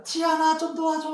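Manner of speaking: calling for help. Retold or imitated. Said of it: "이렇게 불렀던 거예요".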